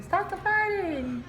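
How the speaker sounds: high, drawn-out mock yell; mock yell without real volume; imitated yelling husband. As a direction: call it out slowly as a fed-up husband. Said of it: "husband yelling".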